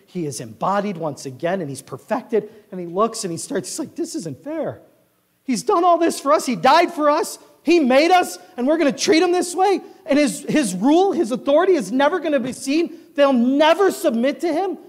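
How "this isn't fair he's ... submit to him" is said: high, loud, rising outrage; indignant protest. Acted. Preacher voices John protesting for God, indignant. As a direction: plead the injustice with mounting force.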